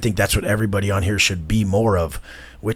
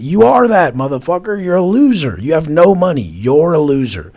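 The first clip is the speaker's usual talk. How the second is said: angry yelling; 8 semitones higher, 8 dB louder than usual. Acted. Ranting at imagined critics.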